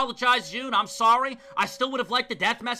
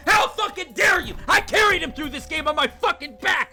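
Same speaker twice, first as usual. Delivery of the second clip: much higher, louder raging yell; furious shout; 9 semitones higher, 7 dB louder than usual. Real, not acted. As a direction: scream at the killer with full rage. Screaming at the game's killer.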